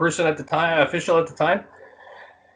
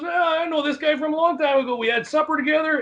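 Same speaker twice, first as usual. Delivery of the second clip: high-pitched chummy recognition; jovial retold reassurance, pitched way up; imitated sudden friendliness; 10 semitones higher than usual. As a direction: play him suddenly all pally. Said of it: "his whole demeanor changed".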